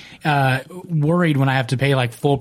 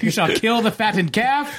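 put-on old-time proclamation; 9 semitones higher than usual. Joins bit with biblical proclamation.

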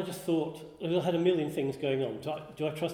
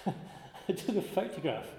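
laughing; 5 semitones higher, slower than usual. Real, not acted. Laughing at own pointless caution.

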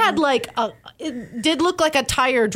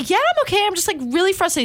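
strained reassurance; 10 semitones higher than usual. Retold or imitated. Retells answering the valet.

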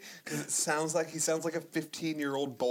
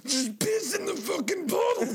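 imitating a teary guy, much higher; mock pleading; 13 semitones higher than usual. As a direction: beg him high and strained to piss in the bottle. Quoting the tearful urging guy.

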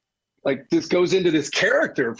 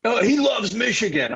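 higher-pitched mock fan complaint; imitated fan accusation; 7 semitones higher than usual. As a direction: play the aggrieved fan, pitched up. Acting out fans' accusation.

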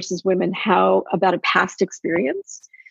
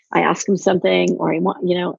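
ordinary talk, nothing marked

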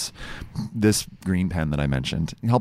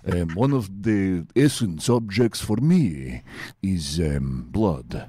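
Dracula voice; slower than usual. Pretend. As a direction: play a slow, creepy Count Dracula.

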